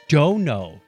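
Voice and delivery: ordinary talk, nothing marked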